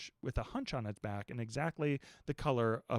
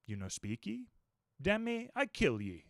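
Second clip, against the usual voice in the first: Queequeg threatening; 7 semitones higher than usual. A narrator's reading. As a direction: menace him, words dragged out. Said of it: "he said at last".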